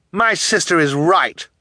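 high, loud protest; crying out. A narrator's reading. Eldest orphan backing her sister, crying out. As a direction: cry it out indignantly. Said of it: "Violet cried".

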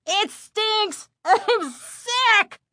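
high, choking cries of revulsion; disgusted crowd cries. A narrator's reading. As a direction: gag and cry out at a stench. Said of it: "the choking and the gagging".

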